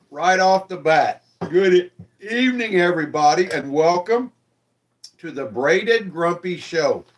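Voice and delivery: lightly announcer-style welcome to the audience; show host opener. Acted.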